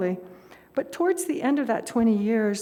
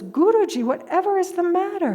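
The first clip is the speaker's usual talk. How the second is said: higher, louder, rushing in alarmed; 9 semitones higher, 5 dB louder than usual. Retold or imitated. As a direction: burst in asking what's wrong. Woman rushes in alarmed.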